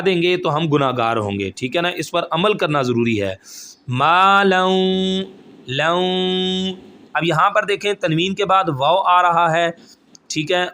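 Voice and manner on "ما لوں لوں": ما لوں chanted slowly, the ما held and لوں sung out; tajweed chant, drawn-out syllables; slow recitation. Shown as a demonstration. Teacher models the recitation for students, slow chant. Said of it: "ما کھینچیں گے نہیں".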